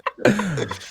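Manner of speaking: big exasperated burst of laughter; loud laugh. Real, not acted. Laughs at team's exit.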